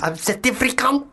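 put-on South African accent. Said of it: "Give me an accent".

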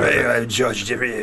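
slow, broad Scottish greeting; thick Scottish accent. Shown as a demonstration. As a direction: roll it out in a heavy Scottish brogue. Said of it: "thick Scottish accent".

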